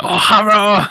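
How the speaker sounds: exaggerated high Chinese accent. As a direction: ham up a big Chinese accent. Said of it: "I just have to give it the big Chinese accent".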